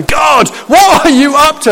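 high mock shout to God; humorous exasperation. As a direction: holler it in comic disbelief. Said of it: "humorously we ask that question".

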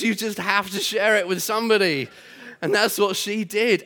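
ordinary talk, nothing marked

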